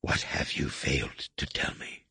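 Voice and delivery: menacing low whisper, breath only. A narrator's reading. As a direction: lean in and hiss the question softly. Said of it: "dropping his voice to a whisper".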